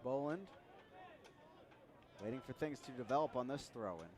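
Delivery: ordinary talk, nothing marked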